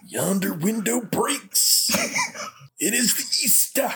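hammy stage actor. Pretend. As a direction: ham it up like a Victorian tragedian. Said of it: "Here's something for Andy to laugh at me for".

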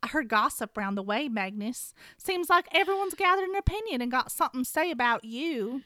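hammed-up Southern warrior; Southern accent. Shen Yun accusing Alec, a light Southern accent. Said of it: "Shen Yun is gonna be played by myself".

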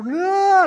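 high loud mock cry; dying cry. Preacher mocks fainting person, high loud wail. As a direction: wail as you collapse. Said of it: "kinda go".

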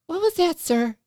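high, syrupy sing-song teacher; sugary teacher voice. Retold or imitated. Teacher welcoming the kids to music class, high and syrupy. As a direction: gush over the children, sweetly high.

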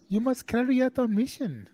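high, hammed-up fake German accent; a bad German accent played for laughs; terrible German accent. Joking about an inner voice with a German accent, bad German accent. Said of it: "that was a terrible German accent".

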